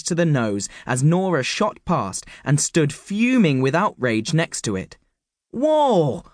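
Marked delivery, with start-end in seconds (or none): 5.5-6.2 startled high whoa; exclaimed. A narrator's reading. Woodstock startled as Nora storms up. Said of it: "exclaimed Woodstock".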